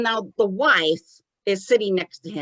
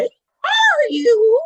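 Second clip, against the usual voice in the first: high, loud, drawn-out puppet question; Tommy; 7 dB louder than usual. Acted. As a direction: squeal the question like a childlike spirit. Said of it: "He's like".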